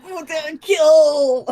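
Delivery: high, loud cry of kill; shouting kill. Retold or imitated. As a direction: yell it like a frenzied attacker. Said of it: "I'm like".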